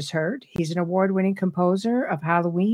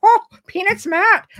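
much higher and louder, mock-startled; startled exclamation; 13 semitones higher, 8 dB louder than usual. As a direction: jolt up like you just got a fright. Startled mom reacting to daughter's voice.

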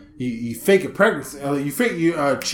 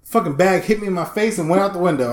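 normal talking voice

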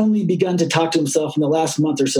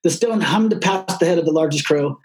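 plain reading voice

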